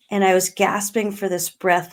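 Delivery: ordinary talk, nothing marked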